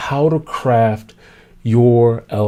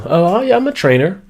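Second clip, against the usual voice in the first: higher, offhand one-liner; lame introduction; 6 semitones higher than usual. Shown as a demonstration. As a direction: toss off your job title. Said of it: "That was a very lame introduction".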